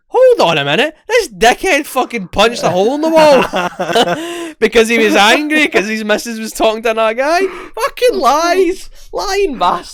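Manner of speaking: high, loud, outraged disbelief; laughing in outrage at the obvious lie; incredulous outburst. Retold or imitated. To his friend about Jack's lie, high outraged disbelief. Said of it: "looking at my phone going".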